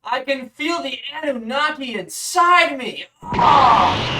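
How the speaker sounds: high, breathless mock rapture; hammed-up spiritual ecstasy. Mock ecstatic reaction to exercise.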